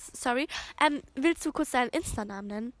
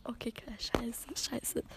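slow, softer, hushed; whispered swear; 3 semitones lower, 12 dB quieter, slower than usual. Real, not acted. Host whispering a swear as someone turns up. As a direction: whisper it so nobody hears. Something startles them near the end.

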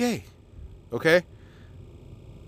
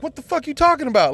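higher, lightly voiced puzzled kid; a kid blurting out his confusion; mild imitation of a confused child; baffled kid; 9 semitones higher than usual. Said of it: "the kid's like".

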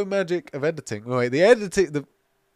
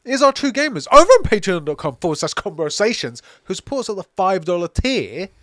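ad read; 5 semitones higher, 4 dB louder than usual. Acted. Podcast sponsor plug.

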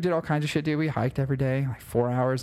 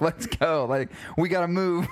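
higher, urgent retold panic; freaking out; 7 semitones higher than usual. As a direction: imitate her freaking out, pitch pushed up. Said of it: "She's freaking out".

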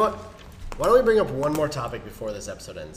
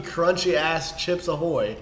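ordinary talk, nothing marked

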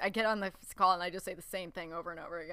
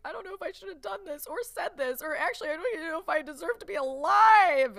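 high, whimpering fake cry; hammed-up tearful self-pity; mock whiny despair; 10 semitones higher, 10 dB louder than usual. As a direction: whine it like a hammed-up meltdown. Acting out her own insecure venting.

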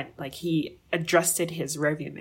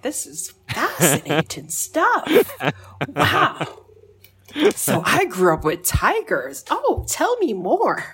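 higher, louder, slow sarcastic wonder; mock amazement; 7 semitones higher, 6 dB louder, slower than usual. Hearing the lady explain her life, mock-amazed and sarcastic. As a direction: gush with fake fascination.

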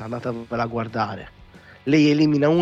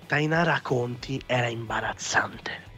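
hushed aside; whispering; 5 dB quieter than usual. Real, not acted. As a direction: whisper it slowly.

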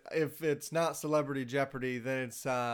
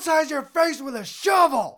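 much higher, louder mock shout; mock furious threat; 11 semitones higher, 9 dB louder than usual. Retelling the skit's angry dad, mock shouting. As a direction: hurl the threat like an unhinged dad. Quoting the screaming dad skit.

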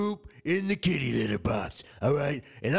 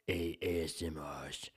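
ASMR whisper; 8 semitones lower, 8 dB quieter than usual. Pretend. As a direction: hush it like an ASMR tingle video. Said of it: "This is A S M R with the A S M [name]".

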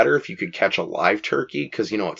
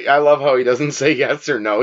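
ordinary talk, nothing marked

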